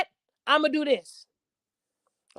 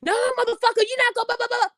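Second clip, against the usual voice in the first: much higher mock of a combative woman; aggressive woman; 10 semitones higher than usual. Mocking an aggressive woman arguing with her man, high and combative. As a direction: cut him off like a woman spoiling for a fight. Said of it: "Men don't like a woman that's aggressive".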